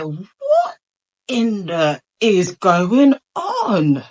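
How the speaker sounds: high, loud disbelieving cry; shocked outburst. Retold or imitated. Retelling her stunned reaction to dad's arrest, a loud shocked cry. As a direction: blurt it out in total shock.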